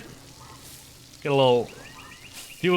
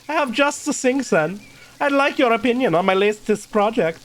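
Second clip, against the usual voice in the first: German character voice; German accent; 6 semitones higher than usual. Acted. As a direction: play Klaus with a put-on German accent. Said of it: "I was doing a fucking German voice for".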